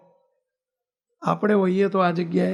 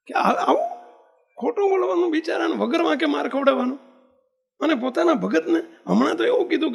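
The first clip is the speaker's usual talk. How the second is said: much higher, incredulous protest; outraged on his behalf; indignant rise; 7 semitones higher than usual. Real, not acted. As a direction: voice climbing in disbelief. Preacher's indignant outburst.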